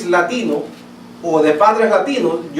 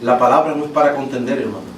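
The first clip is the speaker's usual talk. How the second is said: mock pious advice; 7 semitones lower, faster than usual. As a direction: parody a sanctimonious brother dodging debate. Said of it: "Hay personas que dicen". (imitando con burla a un hermano piadoso, más grave y apagado)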